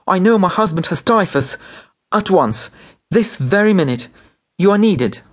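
high, urgent insistence; crying out. A narrator's reading. Wife demanding the doctor come at once, crying out. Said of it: "cried Nelly".

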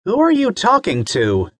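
a curious mom. Acted. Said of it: "his mommy".

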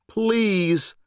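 high pleading word; begging. Acted. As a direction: beg for mercy. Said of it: "he begged".

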